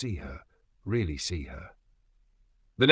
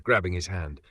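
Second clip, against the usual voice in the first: plain reading voice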